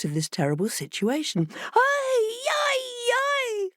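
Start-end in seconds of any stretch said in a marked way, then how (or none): 1.7-3.7 much higher, hammed-up wail; fox wailing. A narrator's reading. Fox hearing his heart is wanted, mock wail. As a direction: wail it theatrically. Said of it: "fox wails".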